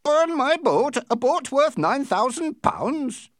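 high-pitched, indignant disbelief; outraged. A narrator's reading. Captain protesting at burning his valuable boat, outraged. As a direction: protest loudly, voice leaping right up.

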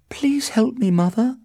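high-pitched desperate plea; high, distressed daughter; crying out. A narrator's reading. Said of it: "she cried".

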